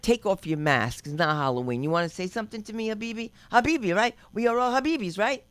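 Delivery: loud challenge climbing in pitch; acted-out loud taunting; mock confrontation. Acting out confrontation with veiled woman.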